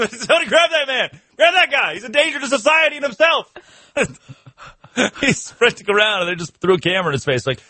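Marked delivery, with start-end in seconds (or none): 0.0-3.5 high-pitched cries for help, hammed up; mock alarm shouting. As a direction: raise a frantic fake alarm, voice way up. Acting out alarm at a chase.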